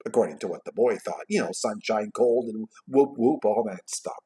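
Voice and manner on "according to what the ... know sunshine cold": plain reading voice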